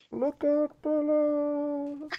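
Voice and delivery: soft, high, drawn-out far-away call; a shout heard faintly from high above; faint distant echo. Acted. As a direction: stretch it out like a fading echo. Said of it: "they hear the faintest echoes from above".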